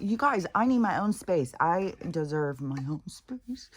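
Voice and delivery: high, insistent plea, slowing at the end; mock protest. Telling crowding pets she needs space, mock exasperated plea.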